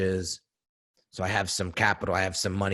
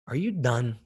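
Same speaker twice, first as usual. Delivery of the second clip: put-on Jersey accent; 3 semitones higher than usual. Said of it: "he has a very thick Jersey accent".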